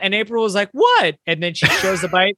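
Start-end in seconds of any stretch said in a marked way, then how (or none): 0.7-1.1 high, short imitation of a puzzled wife; mock startled question. Husband voicing his wife noticing he is emotional, mock startled. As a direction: pop it out high, as if caught off guard.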